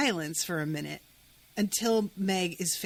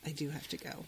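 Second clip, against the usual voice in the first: very soft, low, reluctant giving in; resigned acceptance; 4 semitones lower, 8 dB quieter than usual. Retold or imitated. Girl finally gets it that she has to go, quietly resigned. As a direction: imitate someone sighing and quietly conceding.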